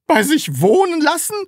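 high, loud aghast question; horrified. A narrator's reading. Taschenbier repeating the Sams's demand, horrified. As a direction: echo it back in horrified disbelief. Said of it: "fragte Herr Taschenbier entsetzt".

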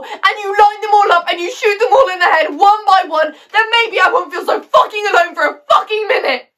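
building from high-pitched agitation to loud fury; high, loud, escalating outburst; furious rant. Acted. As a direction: let the anger mount into a desperate shout. Mother's rant builds to violent outburst.

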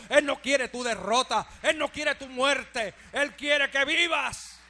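loud, slow, fervent preaching cry; full-force preaching. Acted. Preacher to congregation on God's love, shouting slowly. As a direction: preach it at the top of your lungs, word by word.

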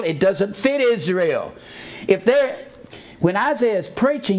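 preacher building to full force; fired-up preaching. Acted. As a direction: preach it hard to the congregation. Preaching against a reading.

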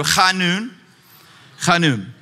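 chanun with a rasping back-of-throat ch; chanun, khah-NOON, the ch scraped in the throat; guttural Hebrew. Shown as a demonstration. Pastor drilling the congregation on the Hebrew word, guttural chanun. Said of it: "you gotta get that little guttural back".

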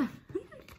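high breathy laugh; real laugh. Real, not acted. The reader laughs after the story ends, a high laugh.

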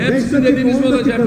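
angry retort. Real, not acted. Shouting back at the chair.

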